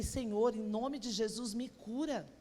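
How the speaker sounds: hushed retold prayer; quiet prayer. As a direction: pray it under your breath, voice dropping. Retold desperate prayer, soft.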